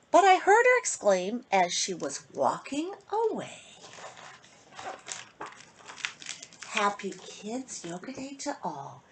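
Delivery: put-on lilting rhymed read-aloud for kids; storybook reading. A narrator's reading. Host reading a bedtime rhyme to kids, lilting. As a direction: read the rhyme to children, sing-song. Reads picture book to children.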